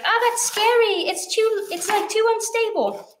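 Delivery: high, nervous mock panic; mock scared. Cooking teacher voicing a nervous viewer's fear, high and mock scared. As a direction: squeak it like a scared beginner cook. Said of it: "you're like".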